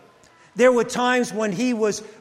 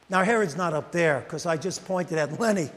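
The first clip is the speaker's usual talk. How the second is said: usual speaking voice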